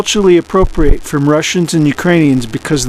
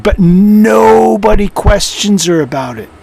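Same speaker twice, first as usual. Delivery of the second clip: ordinary talk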